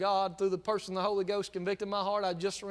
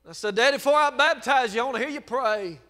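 louder, raised preaching voice; preacher rising again; preaching with force; 4 semitones higher, 9 dB louder than usual. Real, not acted. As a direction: lift it back up to preach.

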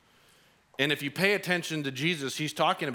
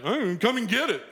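put-on high, teasing kid voice; bratty taunt; 10 semitones higher, 4 dB louder than usual. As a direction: taunt like a spoiled brat. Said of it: "he's like".

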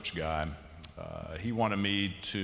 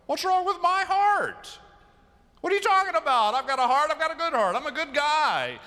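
much higher, louder mock-indignant voice; offended brothers; 7 dB louder than usual. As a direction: play the brothers as squeaky, wounded and defensive. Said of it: "are like".

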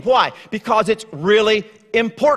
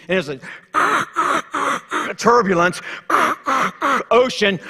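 garbled intercom; 3 semitones higher, much slower than usual. Retold or imitated. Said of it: "you can't understand another word he says".

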